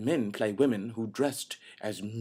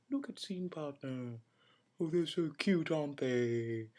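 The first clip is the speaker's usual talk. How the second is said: mock cooing; 5 semitones higher than usual. Host introducing a plastic-duck scene partner, cooing. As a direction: coo over it like a cute pet.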